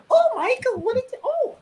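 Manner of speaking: very high mock-innocent female voice; naive Mantis. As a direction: play her startled and naive, in falsetto. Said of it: "her naivete is fantastic".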